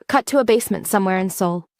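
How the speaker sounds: usual speaking voice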